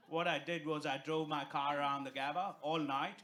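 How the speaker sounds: usual speaking voice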